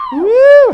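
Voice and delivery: high, loud "woo"; cheering whoop. Real, not acted. Comedian to gym members, loud cheering whoop. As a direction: whoop it up with the crowd.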